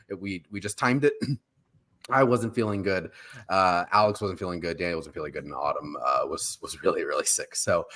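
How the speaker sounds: ordinary talk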